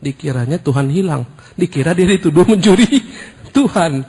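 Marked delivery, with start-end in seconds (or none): 1.6-4.0 laughing. Real, not acted.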